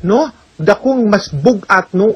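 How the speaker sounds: usual speaking voice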